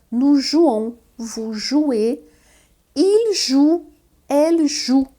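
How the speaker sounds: jouons nasal, jouez with é, joue with pure ou; French conjugation. Shown as a demonstration. Teacher contrasts nous and vous with the others. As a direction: conjugation read clearly. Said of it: "vão ser diferentes".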